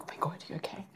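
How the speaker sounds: hushed, worried whisper; breathless whispered check-in; whispered concern. Retold or imitated. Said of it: "people were like".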